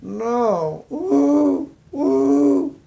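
loud, high Wookiee howl; a hammed-up Wookiee roar; bad Chewbacca. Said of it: "not a very good imitation of Chewbacca".

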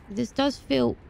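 usual speaking voice

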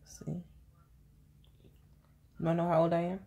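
ordinary talk

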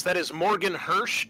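ordinary talk

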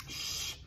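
soft, long hushing hiss; hushing "shh"; whispered shush. A narrator's reading. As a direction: shush the noisy one quietly. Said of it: "the others whispered".

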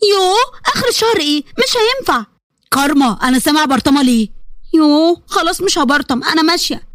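squirm out of it innocently; whiny little sister. Acted. Karma protests, then gives in.

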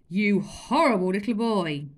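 put-on loud angry roar at the boy. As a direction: bellow at the naughty boy. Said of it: "he roared".

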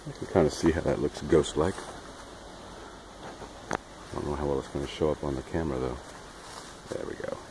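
low, soft muttered aside; quiet aside. Real, not acted. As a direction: murmur it to the camera. Dad aside to camera.

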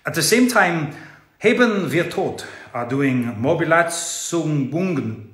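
hammed-up German accent, reading aloud. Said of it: "I'm gonna do the accent".

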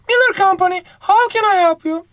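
high put-on female voice answering the phone; woman receptionist voice. Teacher voicing the company receptionist, high female voice. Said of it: "burada bir bayan konuş".

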